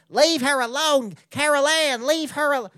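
high, drawn-out Southern exorcist; hammed-up high medium's wail; Zelda Rubinstein. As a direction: plead slowly with the spirits in a high voice. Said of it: "impression of Zelda Rubinstein".

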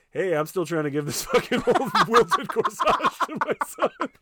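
a desperate, worn-out father hamming it up; cracking up mid-line as the frantic dad; frazzled dad voice. Retold or imitated. Alan Thicke pleads about corsage.

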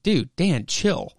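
lightly acted friend stepping in; urging to calm down. Friend intervenes as Dan taunts James.

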